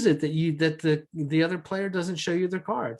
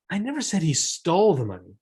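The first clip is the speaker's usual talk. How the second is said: stole punched louder; stress on STOLE; 4 dB louder than usual. Shown as a demonstration. Teacher to class, stressing stole. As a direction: hit stole hard. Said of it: "based on how I accentuated that".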